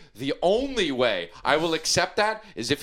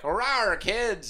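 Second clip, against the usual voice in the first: dinosaur voice; 6 semitones higher than usual. Retold or imitated. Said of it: "put on a hat and be like".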